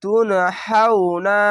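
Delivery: তুনহাউনা chanted slowly with a clear হা; tajweed recitation. Shown as a demonstration. Teacher reciting the word before spelling it. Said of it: "এটি হচ্ছে হা".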